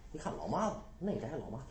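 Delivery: ordinary delivery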